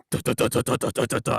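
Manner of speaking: rhythmic duh syllables; domino sound effect. Retold or imitated. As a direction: tap out falling dominoes. Imitating falling dominoes.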